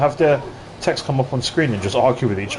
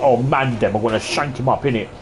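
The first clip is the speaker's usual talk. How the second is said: mock London slang. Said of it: "Instead of me saying things like".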